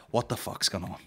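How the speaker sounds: ordinary delivery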